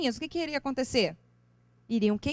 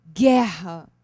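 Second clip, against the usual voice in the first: "guerra" dropped low and hit loud; heavy word; 5 semitones lower, 7 dB louder than usual. Shown as a demonstration. Teacher showing how heavy "guerra" sounds, loud and low. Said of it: "Não pesa?".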